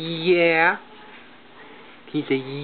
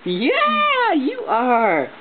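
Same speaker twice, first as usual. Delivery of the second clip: excited coo; 9 semitones higher, 4 dB louder, much slower than usual. Real, not acted. Parent cooing at baby.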